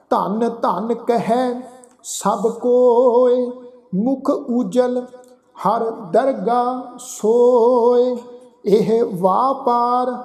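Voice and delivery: slow, chant-like Gurbani reading; drawn-out verse with pauses between phrases; scripture recitation. Shown as a demonstration. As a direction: recite slowly, stretching each line end.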